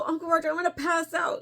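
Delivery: high, hurried mock panic; retold freaking out. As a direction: rush it out as if about to faint, playfully. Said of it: "I'm freaking out".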